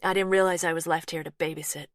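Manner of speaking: a firm, quick American twang; lightly voiced American woman, firm and a bit stung; Laurie's American twang, defensive. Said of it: "softly spoken American twang".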